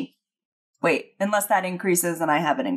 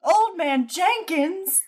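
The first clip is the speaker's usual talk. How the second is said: high, drawn-out cartoon exclamation; hammed-up, high-pitched gasp of recognition; mock unmasking cry; 11 semitones higher, much slower than usual. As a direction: call out the villain's name like a cartoon reveal. Mock Scooby-Doo unmasking cry.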